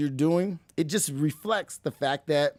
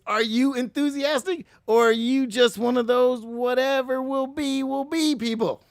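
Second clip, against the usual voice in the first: higher and louder, pushing the phrase as a jab; challenging; 7 semitones higher, 4 dB louder than usual. Shown as a demonstration. Challenging the listener to drop the blasé attitude, raised and punchy. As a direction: throw it at them as a dare. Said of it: "the question is".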